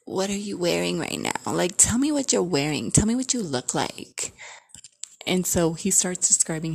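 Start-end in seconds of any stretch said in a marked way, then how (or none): 0.0-4.3 put-on flirty coaxing with swooping pitch; flirty phone voice. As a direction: coax playfully in swoops, voice put on.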